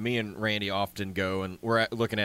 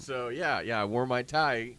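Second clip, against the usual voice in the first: ordinary talk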